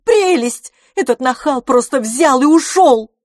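high, loud outrage; indignant cry. A narrator's reading. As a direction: exclaim furiously about the cad. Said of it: "вскричала мисс Дюбари". (возмущённо, громко и высоко)

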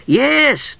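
high, eager kender yes. A narrator's reading. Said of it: "Tass said eagerly".

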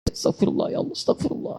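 breathless whisper of repentance; whispered istighfar. Acted. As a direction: whisper it as if near tears. Preacher leads weeping istighfar. (istighfar berbisik)

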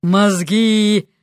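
drawn-out zombie groan; zombie moan. A narrator's reading. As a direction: moan it like the hungry undead. Zombie moans for brains.

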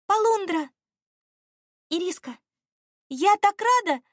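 high, loud, delighted exclamations; joyful reunion. A narrator's reading. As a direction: burst out happily, pitched high. (радостно, высоко и громко)

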